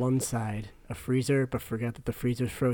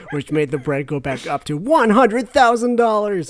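plain reading voice

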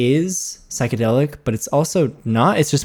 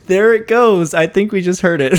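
amused; 7 semitones higher, 6 dB louder than usual. Real, not acted. Laughing at cat mid-recording.

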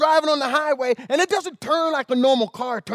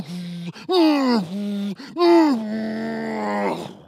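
grunting pushes like a dozer; strained hums, one per shove; mouth-made digging grunts; effort grunts; much slower than usual. Retold or imitated. Mimicking bulldozer pushes.

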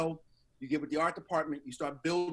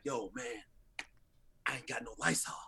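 hushed paranoid whisper; 5 semitones lower, 7 dB quieter than usual. Retold or imitated. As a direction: whisper it like a secret. Buddy quoted whispering about Lysol.

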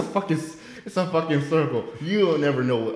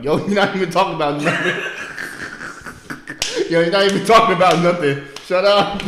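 mock annoyed callout; 6 semitones higher, 5 dB louder than usual. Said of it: "the guy behind him was like".

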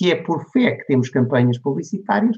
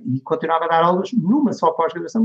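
usual speaking voice

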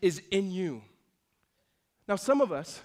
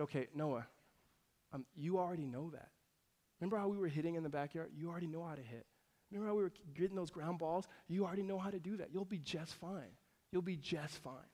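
put-on soft, hushed reassurance to a small child; reassuring dad; 11 dB quieter than usual. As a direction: gently settle a worried kid, voice kept well down. Dad reassures his crying son.

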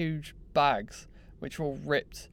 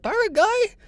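Paraglide? squeaked far higher and louder as a question; imitating the Turkish guy; 8 dB louder than usual. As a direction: mimic the local's eager, much higher Paraglide?. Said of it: "he couldn't even say it".

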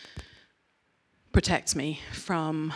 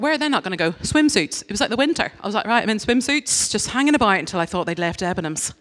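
higher, louder, breathless storytelling; excited retelling; 4 semitones higher, 5 dB louder than usual. Retold or imitated. Preacher telling the congregation her escape, excited and loud. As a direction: race through the hiding story.

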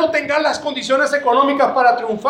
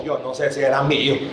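low, soft, given-up voice; defeated, unsure mumble; 9 semitones lower, 4 dB quieter than usual. Retold or imitated. Believer after long wait, giving up on promise, low defeated mumble. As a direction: let the hope drain out. Faith fading over time.

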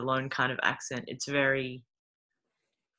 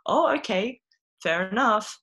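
high, louder mock shock at the ending; mock surprise; 6 semitones higher, 3 dB louder than usual. Reader surprised by the grim fairy tale ending, mock shock. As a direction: play it like a startled reader going oh, okay.

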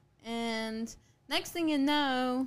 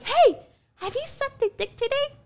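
much higher, imitated tripping babble; high-pitched voice; 5 dB louder than usual. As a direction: put on a high voice to accost someone. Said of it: "I was saying in the high-pitched voice".

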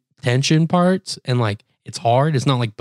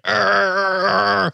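high straining "arr" in imitation; mock grunt of exertion; effortful growl; 8 semitones higher than usual. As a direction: mimic grinding an elbow into a back. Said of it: "dig my elbow into his back".

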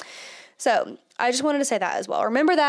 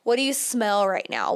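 ordinary delivery

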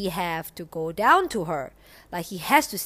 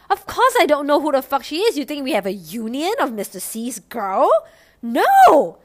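loud, high, indignant rant; exasperated retort; 6 semitones higher, 9 dB louder than usual. Retold or imitated. What she wanted to tell Mister C, exasperated retort. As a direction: act out fed-up disbelief, ending on a loud no.

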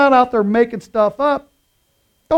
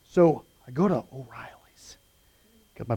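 whisper; 7 semitones lower, 5 dB quieter than usual. Real, not acted. Confiding start of story.

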